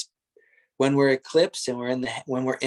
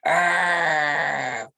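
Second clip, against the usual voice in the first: mock angry growling cry; pretend anger; much slower than usual. Shown as a demonstration. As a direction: ham up a fake burst of anger as a demo. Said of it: "you can even just pretend you're angry".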